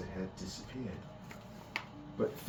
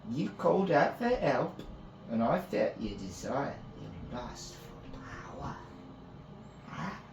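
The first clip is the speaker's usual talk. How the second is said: coaxing fae priestess; 8 semitones higher, 4 dB louder than usual. Acted. Priestess tempting Finn with power, coaxing. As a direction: tempt him, lingering on his lust.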